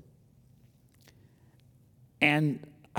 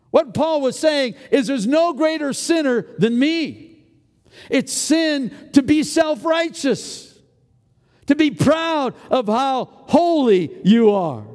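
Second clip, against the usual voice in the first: higher, louder, slow insistent preaching; full preaching; 10 semitones higher, 5 dB louder than usual. Real, not acted. Preacher driving home pride as sin, full preaching force. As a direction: hammer it home to the church.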